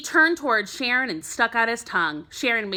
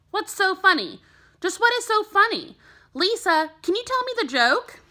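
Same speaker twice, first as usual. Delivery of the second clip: a lightly voiced, higher, stern teacher asking who is laughing; teacher Miss Kelly; 11 semitones higher, 4 dB louder than usual. A narrator's reading. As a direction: read the teacher's lines higher, a little annoyed, quieting a class. Teacher voiced in a read story.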